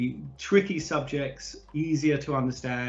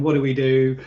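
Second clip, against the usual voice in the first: louder, mildly panicked mimicry; mock panic; 7 dB louder than usual. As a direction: imitate a flustered team, a touch louder. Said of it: "everyone's running around panicking".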